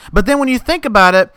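ordinary talk

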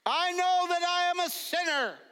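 high, loud confession of sin; praying out loud. Retold or imitated. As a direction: lead the congregation's prayer, loud and high. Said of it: "pray out loud".

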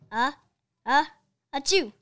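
loud sneeze after two halting uhs; big comic achoo; crowd sneeze. Acted. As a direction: echo the cheerleaders' sneeze. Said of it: "repeated the fans".

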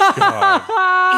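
squealing laughing gasp; shocked laugh. Real, not acted. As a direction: shriek of disbelief. Shock at low rating.

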